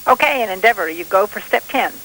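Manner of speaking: police radio. Real, not acted. Mission control over radio link.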